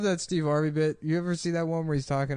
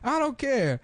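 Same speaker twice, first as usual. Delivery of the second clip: Steve Harvey impression; 12 semitones higher than usual. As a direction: shout it like a wild soldier. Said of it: "he's like".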